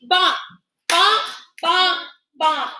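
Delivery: each bonk tapped out separately; pounding out a word. Shown as a demonstration. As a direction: beat out bonk, bonk, bonk, bonk on each tap. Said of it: "I clapped once, I snapped once".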